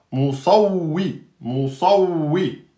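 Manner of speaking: "Moussaoui" with heavy s then light w; teacher model; model word. Shown as a demonstration. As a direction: say "Moussaoui" slowly, twice. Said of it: "entre deux emphatiques".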